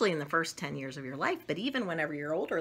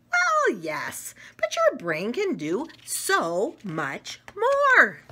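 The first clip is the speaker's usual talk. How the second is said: lightly voiced character; 4 semitones higher, 5 dB louder, slower than usual. Book character answering.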